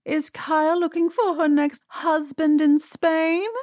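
put-on high, slow British accent; Dorit. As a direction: put on a hammed-up British lady voice. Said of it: "I should do her British accent".

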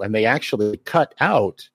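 normal talking voice

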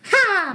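high sudden shriek; a sharp high yelp; alien shriek. Retold or imitated. Alien imitated at window.

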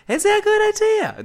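a clumsy put-on accent for Beth's question; terrible Beth accent. Host mimicking Beth's reply to Tim, in a bad put-on accent. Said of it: "That, that was a terrible accent".

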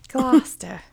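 GLOUCESTER in a British accent, final R dropped. Shown as a demonstration. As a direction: say "Gloucester" the British way, as two syllables. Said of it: "I can do my accent".

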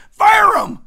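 very high, very loud, explosive; fired-up real yell; shouted. Real, not acted. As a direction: bark the order with full force. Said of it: "Sorry for getting all fired up".

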